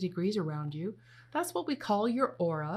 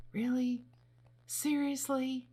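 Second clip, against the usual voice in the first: higher, slow incredulous; can't believe it happened; mock disbelief; 6 semitones higher than usual. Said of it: "It could be as simple as".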